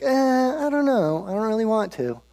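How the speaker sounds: mock-reluctant, pitched well up. Acting reluctant Balaam's reply to Balak.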